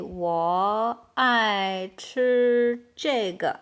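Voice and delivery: drawn-out, word by word; slow model sentence. Shown as a demonstration. Teacher modelling "I love to eat this", very slowly. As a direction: stretch every syllable for learners to copy.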